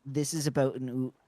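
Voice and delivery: Canadian accent, "about" said as "a-boot"; Canadian "oot" shown against the plain "outhouse". Shown as a demonstration. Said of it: "So, like, if I say".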